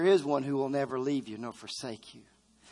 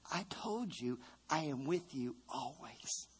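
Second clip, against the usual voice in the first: soft slow refrain; 12 dB quieter, slower than usual. Acted.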